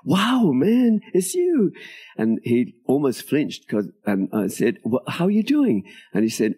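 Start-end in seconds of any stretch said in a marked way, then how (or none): 0.0-1.7 much higher, drawn-out astonished exclamation; amazed greeting. Retold or imitated. Writer spotting the officer in the signing line, amazed. 4.8-5.8 high-pitched, stumbling surprised hello; surprised greeting. Retold or imitated. Writer greeting the officer who almost flinched, surprised.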